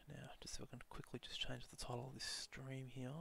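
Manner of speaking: hushed aside while fiddling with settings; whispering. Real, not acted. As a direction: whisper it under the breath. Aside while changing stream title.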